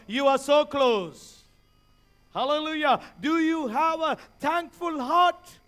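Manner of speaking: fervent, insistent pulpit delivery; full-force preaching. Real, not acted. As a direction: proclaim it to the whole congregation.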